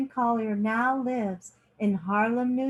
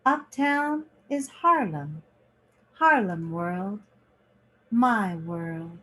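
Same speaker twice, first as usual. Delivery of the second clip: lively picture-book reading, lingering on each page; reading to children. A narrator's reading. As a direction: read it like story time for kids. Reads picture book aloud.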